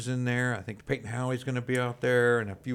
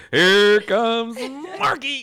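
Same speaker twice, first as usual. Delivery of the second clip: much higher, louder race caller, laughing; horse race announcer; 11 semitones higher, 11 dB louder, much slower than usual. Retold or imitated. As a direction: call it like the horses are coming. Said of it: "like a horse race".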